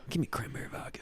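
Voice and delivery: dad asking for a drink; mock dad order. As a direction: whisper the order.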